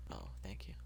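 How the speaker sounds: soft unvoiced whisper; barely whispered gratitude; whispered thanks. Real, not acted. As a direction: breathe the thanks with no voice.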